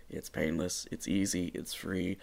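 ordinary talk

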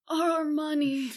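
slow, sinking lament; mock dismayed administrator. Administrator seeing broken glass, mock dismay. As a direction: mourn the money lightly. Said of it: "some administrator's like".

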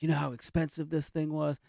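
high-pitched hammed-up scolding mom; mock angry mom. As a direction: play the mom fussing over the price, lightly exaggerated. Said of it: "she gets so mad".

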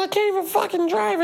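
squeaky bratty brag, fast; imitating a little girl showing off; high mock child voice; very high mock kid voice. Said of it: "I just seen a video today, like".

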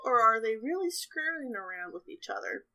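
ordinary delivery

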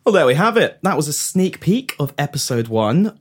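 ordinary talk, nothing marked

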